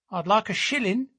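high little lad's voice; small boy. A narrator's reading. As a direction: pipe up like a six-year-old.

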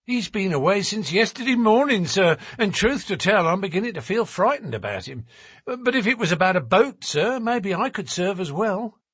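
high, talkative woman confiding her worry; worried working-class wife. A narrator's reading. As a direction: chatter anxiously but eager to help. Narrator plays worried wife.

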